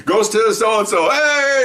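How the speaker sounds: high, drawn-out announcement rising into a cheer; winner announcement. Retold or imitated. Presenter to third-place squad, announcing and cheering. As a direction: present the prize and whoop for them.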